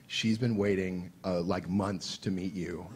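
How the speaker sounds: lightly voiced dad, murmured over the shoulder; a hint of a quiet proud father. Father speaking behind him.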